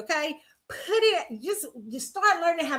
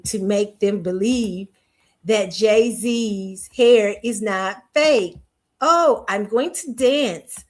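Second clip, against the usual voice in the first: slow, stilted mechanical robot voice; 2 semitones lower, slower than usual. Retold or imitated. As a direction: recite each word like a programmed machine. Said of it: "they just wind you up".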